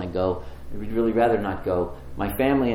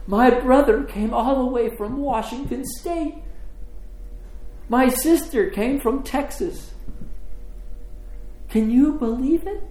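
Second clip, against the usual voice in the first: high, overcome, marvelling voice; tearful joy; 13 semitones higher than usual. Retold or imitated. Sister telling friends her siblings travelled far, tearfully amazed. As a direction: imitate someone crying with happy disbelief, pitched high. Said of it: "and she's crying".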